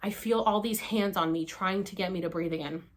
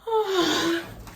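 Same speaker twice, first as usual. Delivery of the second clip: big, high gasping sigh; relieved exhale; 6 semitones higher than usual. Retold or imitated. Breath returning after choking, relieved exhale.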